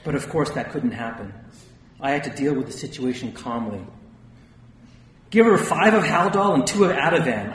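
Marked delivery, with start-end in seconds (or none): 5.3-7.5 shouted order. A narrator's reading. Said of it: "I shouted".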